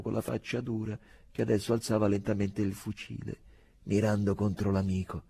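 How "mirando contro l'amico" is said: plain reading voice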